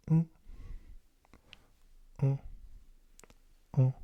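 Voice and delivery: "un" as a short French grunt, pauses between repeats; grunted French vowel. Shown as a demonstration. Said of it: "you're kinda just grunting".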